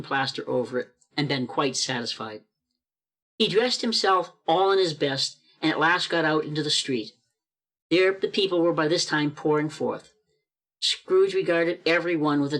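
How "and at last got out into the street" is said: usual speaking voice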